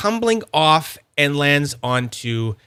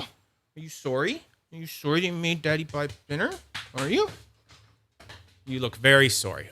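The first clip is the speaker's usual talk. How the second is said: softer, gently teasing the dog; soft teasing pet talk; 7 dB quieter than usual. Real, not acted. Owner gently teasing the dog that knocked the yo-yo, softer pet talk. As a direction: tease the dog tenderly, almost under your breath.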